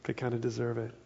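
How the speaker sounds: a hint of smug superiority, said softly; looking down their nose. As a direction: brush them off as lesser people. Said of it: "looking down their nose on the Galileans".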